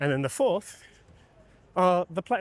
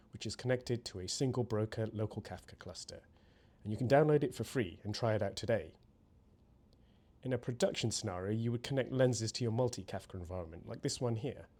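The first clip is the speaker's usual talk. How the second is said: measured, softer tutorial narration; scripted demo voiceover; 5 semitones lower, 9 dB quieter than usual. Acted. Presenter walks viewers through prompting workflows, scripted demo narration. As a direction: read the walkthrough evenly, composed and lower-key.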